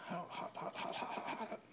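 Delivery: quick breathy hisses of pain; burning fingers. Real, not acted. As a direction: gasp it out as the mesh burns. Grabbing mesh from boiling water.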